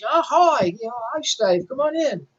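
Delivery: a hammed-up English rocker greeting. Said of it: "I'm doing a very bad imitation of his accent here".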